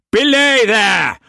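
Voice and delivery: high, slow, loud bellow; shouted order. A narrator's reading. Brock shouting orders to the crew, loud. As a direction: call it down the gangway. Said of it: "Brock shouted down the gangway".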